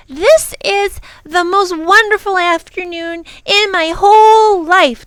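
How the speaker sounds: high, loud, gushing delight; rapturous child. Acted. Lindy declares her delight.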